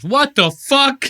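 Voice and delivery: high, loud, slow disbelieving outburst; mock outburst. Host imitating a guest's disbelief at et cetera, loud and high. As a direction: stretch it out high like you're losing your mind. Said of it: "He's, like, losing his mind".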